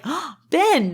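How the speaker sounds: high, gasped surprise at a name; gasp, then jump up in pitch as if amazed; mock astonishment. Podcaster retelling Leia hearing of Obi-Wan, mock astonished.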